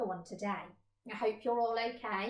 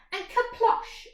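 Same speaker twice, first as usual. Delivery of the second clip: usual speaking voice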